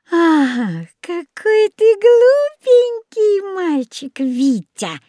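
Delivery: put-on very high, slow cooing old woman; sugary chiding. A narrator's reading. Old woman dismisses Витя's warning, sugary chiding. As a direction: coo reproachfully, stretching it out.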